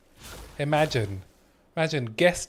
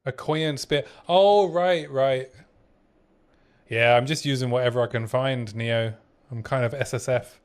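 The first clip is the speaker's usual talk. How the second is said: ordinary talk, nothing marked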